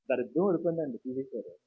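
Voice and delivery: soft mock-confidential remark, fading away; gloating aside. Two-faced neighbour confiding relief to someone nearby, in a quiet aside. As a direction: drop the voice as if gloating privately. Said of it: "పక్క వచ్చి అంటాడు".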